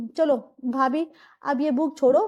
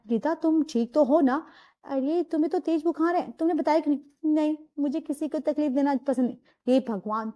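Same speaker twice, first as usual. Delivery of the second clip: ordinary talk, nothing marked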